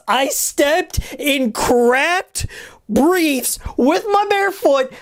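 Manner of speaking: slow, drawn-out disbelief; slow emphatic retelling. Real, not acted. Telling viewers about the shower, slow and drawn out. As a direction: spell it out slowly. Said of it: "repeating the punchline slowly".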